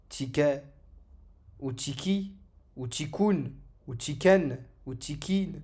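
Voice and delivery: TIKET, TIKI, TIKOUN, TIKEN, TIKIN, each with a vowel after the K; wrong added endings. Shown as a demonstration. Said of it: "Donc ce ne sera pas".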